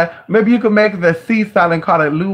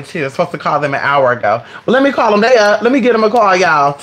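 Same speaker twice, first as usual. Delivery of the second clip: ordinary delivery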